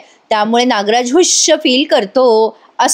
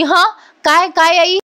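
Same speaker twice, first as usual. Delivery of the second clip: startled reply; 6 semitones higher, slower than usual. Retold or imitated. Startled girl distracted by phone.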